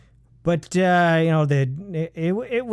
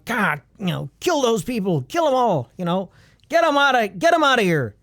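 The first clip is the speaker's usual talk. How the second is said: excited; 6 semitones higher than usual. Retold or imitated. Voicing bloodthirsty movie audience.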